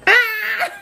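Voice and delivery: high loud yelp into a laugh; pained yelp and laugh. Real, not acted. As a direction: cry out from the burn, then laugh. Chile burning just eaten.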